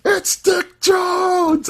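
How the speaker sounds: high, drawn-out exclamation; hammed-up high holler of recognition; excited shout. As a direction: call it out high, stretching each word. Excitedly calling out recognised actor.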